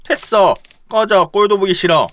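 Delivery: loud, angry get out; angry dismissal. Acted. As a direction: shout him away in disgust. Acted quarrel, sending him away. (크게 화내며 쫓아내기)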